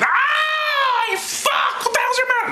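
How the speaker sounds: very high, squealing outraged yelp; hammed-up screaming protest; mock scream of pain. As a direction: shriek as if burned, for laughs. Invents Isaiah's reaction to burning.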